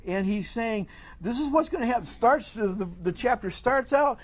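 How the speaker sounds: high-pitched, impassioned preaching; emphatic preaching. Real, not acted. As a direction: drive the teaching home, pitch lifted high. Preaches on God's sovereignty.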